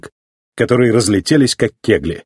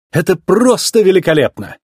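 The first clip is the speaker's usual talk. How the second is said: higher-pitched enthusiasm; delighted; 7 semitones higher than usual. A narrator's reading. Sally sees townsfolk proud of Radiator Springs, delighted. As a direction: gush with admiration. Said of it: "Она пришла в восторг".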